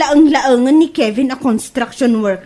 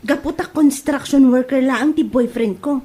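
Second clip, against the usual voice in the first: ordinary talk, nothing marked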